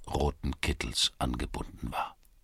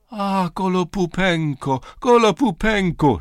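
high, loud, delighted shout of the name; joyful cry; 13 semitones higher, 8 dB louder than usual. A narrator's reading. Bound Czerewik crying out in joy to Gritzko. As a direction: greet him with relief and joy. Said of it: "schrie Czerewik voller Freude auf".

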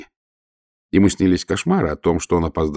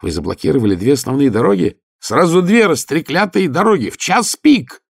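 incredulous shout; 7 semitones higher than usual. A narrator's reading. Shouted exchange in rushing coach.

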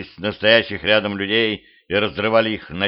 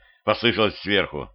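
ordinary delivery, nothing marked

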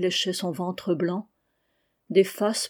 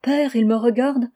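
put-on nervous little girl; 4 semitones higher, 5 dB louder than usual. A narrator's reading. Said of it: "dit encore avec un petit rire".

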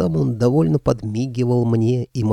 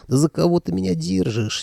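feigned outrage, pitched up; mock indignation; 8 semitones higher than usual. A narrator's reading. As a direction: play at being offended. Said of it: "деланно возмутился Михалыч". (притворное возмущение)

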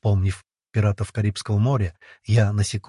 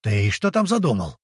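ordinary delivery, nothing marked

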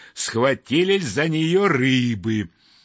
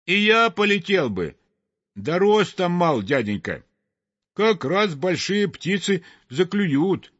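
higher, small-bird voice; high childlike reply; little snipe, timid excuse; 5 semitones higher than usual. Acted. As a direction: explain sheepishly that you are too little. Little snipe answers the sweep.

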